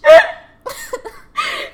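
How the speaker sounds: loud, high-pitched burst of laughter. Real, not acted. Laughs at own story.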